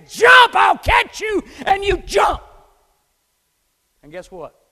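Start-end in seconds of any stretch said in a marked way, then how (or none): 0.1-2.4 huge, slow, high shout; shouted command. Acted. God shouting to the hesitant believer to jump. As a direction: roar it across the dark cliff. Climax of God's call.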